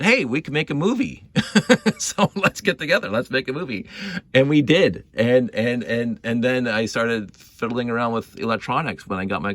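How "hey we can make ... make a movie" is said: upbeat, higher retold suggestion; eager friends. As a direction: sell the idea with a pitched-up grin. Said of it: "and they said".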